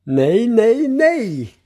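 high, loud wail of protest; screamed. A narrator's reading. Grandfather as the flour flies everywhere, crying out. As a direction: cry out no, climbing in pitch. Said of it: "Morfar skriker".

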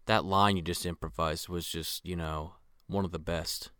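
low, soft quoted compliment; hushed, low voice, as if quietly impressed; low-key praise. Retold or imitated.

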